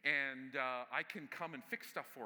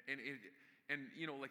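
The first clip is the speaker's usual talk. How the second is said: ordinary talk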